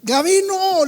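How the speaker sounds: high calling shout of "¡Gavino!"; retold urgent shout; shouted name. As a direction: yell "¡Gavino!" for help from afar. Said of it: "le grité".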